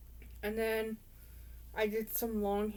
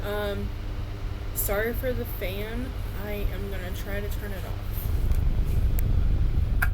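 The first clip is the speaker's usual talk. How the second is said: raised voice over fan noise; louder, pushing past the fan; talking over a fan; 8 dB louder than usual. Real, not acted. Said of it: "hopefully you guys can hear me better now".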